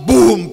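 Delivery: a sudden loud spoken boom; imitated stone thud. Preacher acting out stones thrown at David, loud thud sound. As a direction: slam the word out like a rock hitting.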